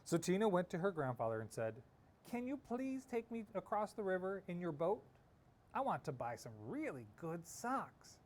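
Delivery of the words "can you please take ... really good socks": put-on bright pleading child voice, pitched up; girl's voice, lifted high and hopeful; eager little girl. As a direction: ask like an excited young girl begging. Tina asks grandfather.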